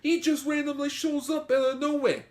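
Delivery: high, loud Brooklyn dub voice; hammy Brooklyn wise guy; Brooklyn accent. Pretend. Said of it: "dub being like Joey from Brooklyn".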